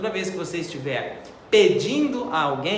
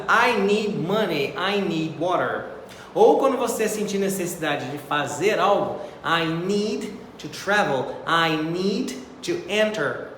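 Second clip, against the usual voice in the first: slow, careful classroom examples; slower than usual. Shown as a demonstration. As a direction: say each example slowly. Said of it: "slow example sentences".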